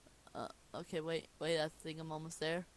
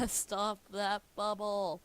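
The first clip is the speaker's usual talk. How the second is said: slow, higher, louder "Stop that bubble."; hammed-up slow "Stop that bubble."; quoted catchphrase; 5 semitones higher, 6 dB louder, much slower than usual. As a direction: drag out each word like the meme. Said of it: "I get the reference".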